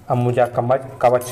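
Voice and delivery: ordinary talk, nothing marked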